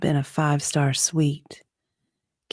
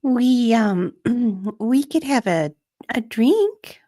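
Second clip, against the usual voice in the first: higher, halting, hesitant with fillers; nervous stammer; 8 semitones higher than usual. Acted. Kate to her coworkers, a hint of nervous halting stammer. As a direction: fumble for the words before blurting it.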